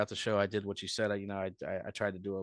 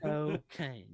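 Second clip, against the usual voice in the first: "cocaine" pitched up, set apart as a catchphrase; jig-style cocaine; 6 semitones higher than usual. Shown as a demonstration. Comic repeats his bit, "cocaine" high and playful. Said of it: "just like that".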